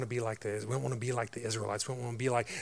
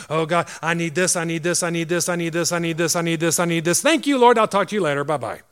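louder and louder, pushy begging for things; greedy laundry-list prayer; 5 semitones higher, 11 dB louder than usual. Pretend. Churchgoer hurling a wish list at God, mock greedy shouting. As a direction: demand it all from God, then hang up breezily. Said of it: "having a laundry list of things".

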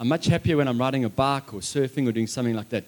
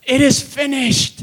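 crying out; 10 semitones higher, 8 dB louder, slower than usual. Acted. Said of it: "he cries out".

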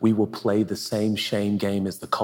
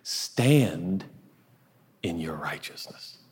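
starts spoken, drops to a whisper; fading to whisper; 3 semitones lower, 3 dB quieter, much slower than usual. Acted. Preacher giving the point, then whispering it. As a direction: let the words sink to a hush.

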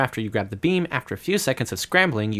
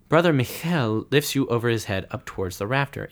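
ordinary talk, nothing marked

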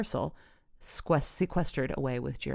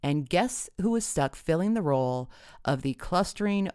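plain reading voice

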